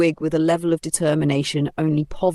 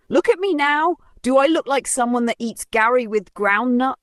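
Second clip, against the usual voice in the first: much higher, proud boast; showing off; 8 semitones higher than usual. Acted. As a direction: strut and brag to the shopkeeper. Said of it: "flipped the wig dramatically and asked".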